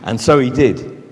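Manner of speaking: soft, low storytelling voice; reading a story to children, soft and low; story narration. Acted. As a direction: draw the children in quietly.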